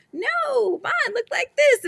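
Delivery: high cartoon animal voice. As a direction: squeak it like a cartoon rabbit. Voicing animals in kids' book.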